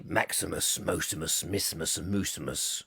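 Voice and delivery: very low, soft mumbled hero; hushed low film-hero voice; Gladiator line attempt. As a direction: drop the voice deep and quiet. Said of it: "something where he goes".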